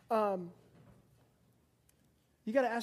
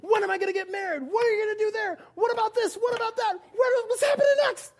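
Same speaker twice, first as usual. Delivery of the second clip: high, loud, fast anxious babble; freaking out; 11 semitones higher, 6 dB louder, faster than usual. Retold or imitated. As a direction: panic like a scared passenger. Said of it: "in the passenger seat freaking out".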